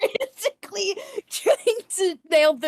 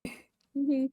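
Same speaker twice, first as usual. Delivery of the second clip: usual speaking voice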